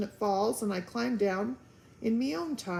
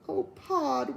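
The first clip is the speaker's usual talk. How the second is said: wailing; 6 semitones higher than usual. Acted. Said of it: "wailed Homily".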